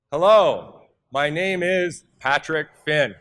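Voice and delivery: big loud hello, the name pushed out slowly; loud confident introduction. Shown as a demonstration. Speaker showing the audience a loud, confident introduction. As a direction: project the name to the whole room. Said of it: "See how much louder I was?".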